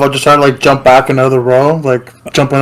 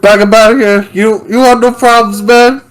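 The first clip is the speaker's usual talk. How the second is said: thug threat; 8 semitones higher than usual. Acted. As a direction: shout it like a jittery street thug.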